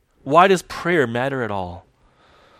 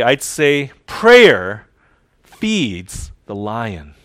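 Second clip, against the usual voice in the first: slow emphatic; 2 semitones lower, 6 dB louder, much slower than usual. Real, not acted.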